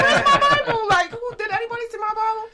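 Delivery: high, loud, laughing panic; hammed-up squealed alarm, quick and loud; mock frantic searching. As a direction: act out hunting frantically for something lost. Acting out past frantic Bible search.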